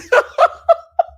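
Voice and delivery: loud, high burst of laughter. Real, not acted. Laughing hard while telling about her son, loud high laugh. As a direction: crack up at the memory.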